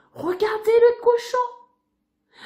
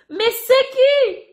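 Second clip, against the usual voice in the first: higher, loud and slow, losing patience; angrier woman at the door; 5 semitones higher, 5 dB louder, slower than usual. Acted. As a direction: ask again, fed up. Woman asks again, angrier.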